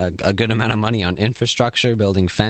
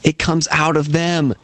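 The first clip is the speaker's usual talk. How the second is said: higher-pitched mock farmer from a sketch; quoting a comedy farmer, pitched up; 6 semitones higher than usual. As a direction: lightly act out an incredulous farmer's bit. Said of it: "It's like".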